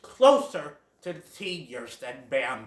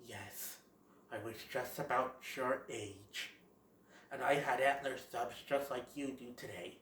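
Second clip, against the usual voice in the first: lower, much softer fatherly voice; Great Prince; 6 semitones lower, 14 dB quieter than usual. Retold or imitated. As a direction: speak low and hushed like a wise old stag. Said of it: "the Great Prince will be all like".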